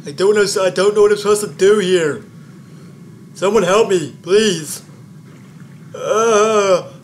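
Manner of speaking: loud, high, desperate cry for help; panicked plea. Real, not acted. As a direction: yell it out, lost and scared. Lost in a horror game.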